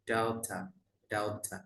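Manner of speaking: British "daughter", crisp t and no final r; British pronunciation. Shown as a demonstration. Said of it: "Kalau British ya". ("daughter" logat British)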